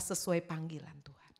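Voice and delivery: whispered urgent appeal; preacher whispering the call to live by God's calling. Real, not acted. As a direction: lean in and whisper it to the congregation. Preacher drops to urgent aside.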